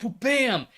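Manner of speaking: high-pitched "whoop, bam!" sound effect; imitated kick impact. Fighter retelling his rival's sudden axe kick, imitated impact. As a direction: act out the blow landing with your voice.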